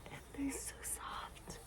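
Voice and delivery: slow, soft, breathy whisper. Real, not acted. Showing off her legs, a slow soft whisper. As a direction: hush it out slowly.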